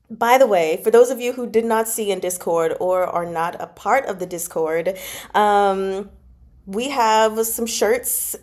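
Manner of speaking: ordinary talk, nothing marked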